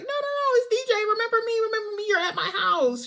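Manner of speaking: very high, pleading mock voice; high-pitched, urgent "it's only me" delivery; frantic reassurance. Retold hasty calming of startled woman.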